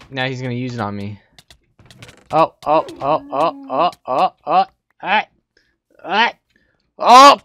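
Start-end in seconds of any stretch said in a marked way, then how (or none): 2.3-7.4 rising frantic cries, louder and higher; panicked yelps. Real, not acted. Gamer caught off guard by another player, panicked yelps. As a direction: yelp in real panic as you're caught.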